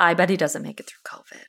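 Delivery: whispered like sharing a secret; gossipy whisper. Real, not acted. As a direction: hush it to a conspiratorial whisper.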